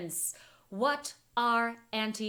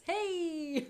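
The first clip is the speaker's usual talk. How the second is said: puppet voice; 7 semitones higher than usual. Pretend. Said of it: "a good puppet voice there".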